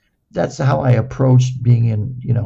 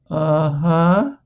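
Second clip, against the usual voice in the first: higher, rising put-on uh-huh; humouring uh-huh; 5 semitones higher than usual. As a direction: play along doubtfully, voice lifting. Mock-agreeing to young therapist in story.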